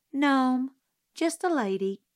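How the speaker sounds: plain reading voice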